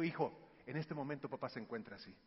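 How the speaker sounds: low, soft, measured explanation; calm father. Retold or imitated. Father telling son his plan to cool off, calm. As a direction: explain it quietly to your child. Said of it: "Y yo le digo".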